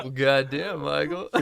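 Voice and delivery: the same line broken up by laughter; laughing repeat of the comeback to Michael. Retold or imitated. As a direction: repeat it cracking up. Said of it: "You're like".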